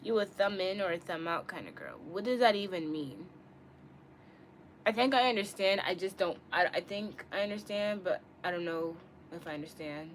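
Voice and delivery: ordinary delivery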